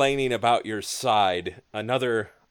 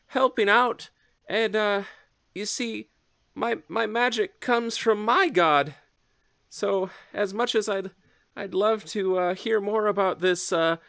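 high, polite dwarf healer; shy and earnest, pitched up; timid healer voice; 10 semitones higher, 4 dB louder than usual. Acted. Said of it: "He says".

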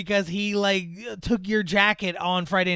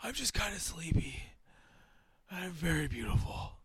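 hushed mock-heartfelt deathbed scene; Kirk. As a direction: murmur dreamily, in love with your own looks. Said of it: "Kirk is just like".

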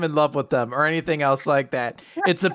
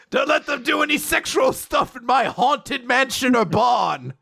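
booming spooky host; 7 semitones higher than usual. Pretend. Spooky host bellowing his rules to the podcast partner. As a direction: announce it like a haunted-house master.

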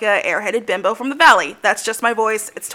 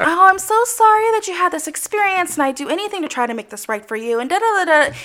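higher, fast, eager apologetic service voice; customer service voice; 6 semitones higher than usual. Retold or imitated. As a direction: rattle off apologies like a cheerful call-center rep. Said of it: "my customer service voice".

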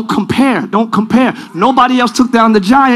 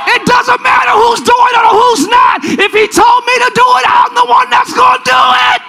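high, sustained revival shout; full-force preaching; 11 semitones higher than usual. Real, not acted. Preacher leading congregation's declarations, high full-force shouting. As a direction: shout each declaration for the crowd to echo.

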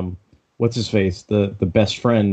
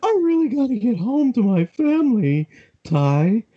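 high mock pleading. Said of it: "same voice actor as Sho".

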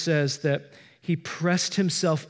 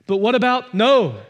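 higher, louder pushback; doubting protest; 5 semitones higher, 8 dB louder than usual. Pretend. Imagined sinner objecting to God's love, loud protest. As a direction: blurt out the doubt.

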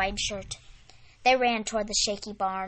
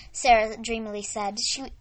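plain reading voice

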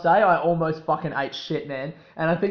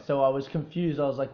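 usual speaking voice

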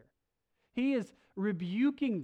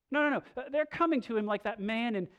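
ordinary talk, nothing marked

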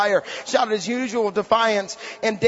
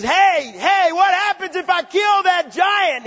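high, drawn-out mock shout; eager calling out; 9 semitones higher, 5 dB louder, slower than usual. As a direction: holler it as the bold young challenger. Acts out David calling out.

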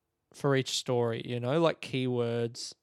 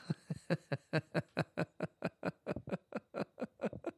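airy giggle at his own joke; breathy laugh; 4 semitones higher, 7 dB quieter than usual. Real, not acted. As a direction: let out a soft breathless laugh.

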